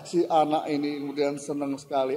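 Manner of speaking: ordinary delivery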